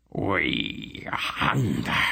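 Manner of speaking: very slow, low beast rumble; hungry monster hound, drawn out; rumbling hound. A narrator's reading. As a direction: let it rumble from deep in the chest. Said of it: "the fiery-eyed hound rumbled".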